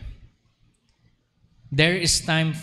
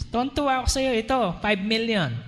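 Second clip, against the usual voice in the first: higher, gushing delight; mock overjoyed giver; 6 semitones higher than usual. Pastor imitating a giddy rich person, high and gushing. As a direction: gush like someone who just got rich.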